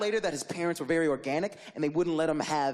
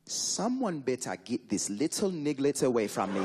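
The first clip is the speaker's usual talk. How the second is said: put-on stern Trinidadian babysitter; 6 semitones lower than usual. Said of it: "the lady goes".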